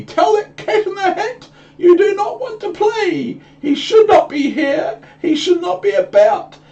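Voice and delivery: very high, squeaky panicked fish; frantic fish voice. Acted. Goldfish begging the children to send the cat away, squeaky and frantic. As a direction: shriek it like a terrified little fish.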